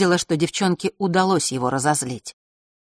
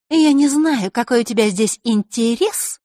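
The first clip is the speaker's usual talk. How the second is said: high, mocking girl's voice; higher girlish mockery; 6 semitones higher, 4 dB louder than usual. A narrator's reading. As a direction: sneer at the intruder. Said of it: "насмешливо покосившись".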